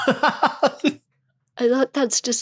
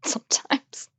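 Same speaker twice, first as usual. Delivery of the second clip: whisper; 3 semitones higher, 6 dB quieter than usual. Real, not acted. Teasing echo of "sometimes.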